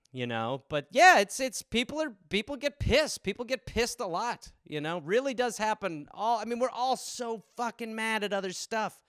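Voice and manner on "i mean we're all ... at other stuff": ordinary talk, nothing marked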